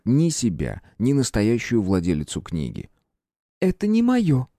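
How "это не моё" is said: princess voice, repeated. A narrator's reading. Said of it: "повторила она".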